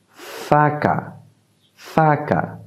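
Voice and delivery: faca said softly and clearly; Portuguese faca. Shown as a demonstration. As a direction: model faca gently. (faca doucement articulé)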